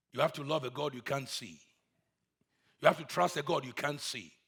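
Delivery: ordinary delivery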